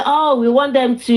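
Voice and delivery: usual speaking voice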